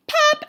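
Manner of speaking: high, loud imitated pop; cork pop. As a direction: burst it out like a cork.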